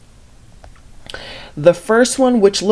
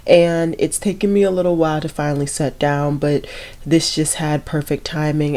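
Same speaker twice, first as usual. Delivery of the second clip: usual speaking voice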